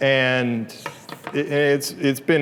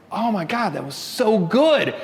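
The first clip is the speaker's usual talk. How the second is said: mock awe; 6 semitones higher than usual. Poet imitates a listener after a great poem, gushing. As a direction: gush like an awed audience member. Voices an awed listener's reaction.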